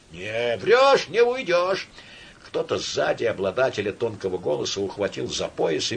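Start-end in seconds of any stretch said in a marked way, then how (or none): 0.1-1.9 raised, insistent voice; threatening cry. A narrator's reading. Pursuer to the supposed thief, threatening. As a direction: warn him he is caught.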